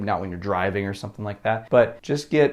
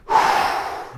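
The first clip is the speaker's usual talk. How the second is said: audible breath. Shown as a demonstration. Alone with music on, a full breath out. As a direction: breathe it out fully. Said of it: "put some music on".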